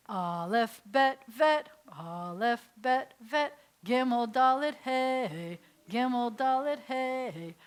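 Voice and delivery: teacher calling out the alphabet for the class to echo; call and repeat. Shown as a demonstration. Teacher leads class in repeating the Hebrew alphabet, chanting. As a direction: chant each letter name clearly, leaving room to repeat. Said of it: "we're gonna repeat after me".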